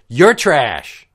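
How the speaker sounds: hammed-up offended shout; mock angry retort. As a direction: blurt it like an indignant drunk. Mimicking girlfriend's retort.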